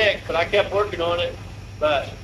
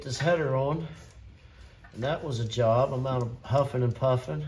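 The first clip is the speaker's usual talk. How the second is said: congested, tired sick voice; 7 semitones lower, 4 dB quieter than usual. Real, not acted. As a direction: talk like you've been sick two weeks. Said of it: "Been sick for two weeks".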